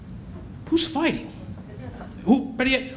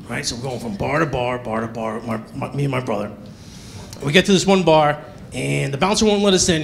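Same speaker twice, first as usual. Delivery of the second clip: ordinary talk, nothing marked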